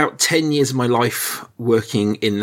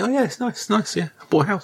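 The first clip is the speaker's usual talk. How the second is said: light, higher-pitched mock contentment; 10 semitones higher, 3 dB quieter than usual. Husband by a pool in Oman while others move, mock contented. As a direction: retell it as an idle poolside remark, pitched up. Mimes lounging poolside in Oman.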